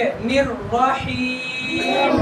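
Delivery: "الرحیم" with a long held "ee"; level chanted "الرحیم", held to the end; drawn-out recitation. Shown as a demonstration. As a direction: stretch the last syllable of "الرحیم". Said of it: "کھینچ کے".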